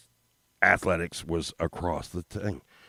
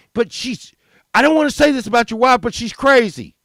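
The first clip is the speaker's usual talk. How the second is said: high blurted complaint; 10 dB louder than usual. Retold or imitated. Kid blurting about the wife.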